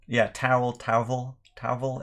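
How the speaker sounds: "towel" with a soft sound between W and V, "tauvel". Shown as a demonstration. Husband imitating his wife's Indian-accent "towel". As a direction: say "towel" with the W blurred toward V. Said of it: "soft W V in between sound".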